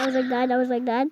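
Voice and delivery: ordinary talk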